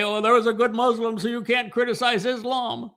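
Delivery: high, sing-song parody of the argument; mocking quote. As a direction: sneer it as a naive excuse. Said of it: "you wanna say".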